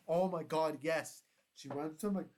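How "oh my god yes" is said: excited cheer. Retold or imitated. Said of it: "I'm like".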